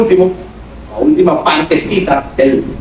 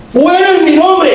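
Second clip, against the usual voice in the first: commanding shout; 12 semitones higher, slower than usual. Acted. Jesus casting out demons.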